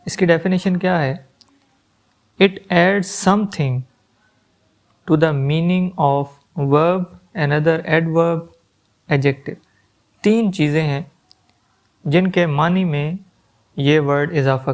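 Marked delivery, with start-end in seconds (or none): 2.4-9.5 very slow, spaced-out words; slow dictation. Shown as a demonstration. Teacher slowly dictating a definition to students, very slow and spaced. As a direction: read it out word by word. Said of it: "teacher dictates definition slowly".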